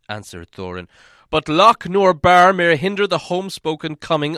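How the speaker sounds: loud, grave and proud; defiant royal rebuttal; Thorin's voice. A narrator's reading. Thorin answers master.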